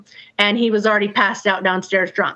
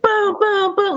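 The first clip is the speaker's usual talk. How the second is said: mock booms; 9 semitones higher than usual. Imitating bangs on the door.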